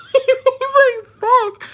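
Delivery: high laugh spilling into the quote. Real, not acted. Retelling dad's reaction at the border, laughing hard. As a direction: laugh through the words. Laughing while quoting dad.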